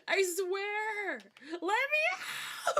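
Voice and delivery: high-pitched, loud play-panicked scream; desperate mock yell. Trapped player yelling at the game to be freed, mock-desperate yell.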